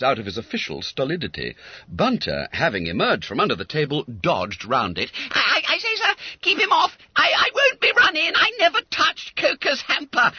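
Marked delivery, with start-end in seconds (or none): 5.3-10.3 very high, loud frantic stammer; a boy begging for protection; panicked pleading. Acted. Boy begging the master to keep the policeman off, high frantic.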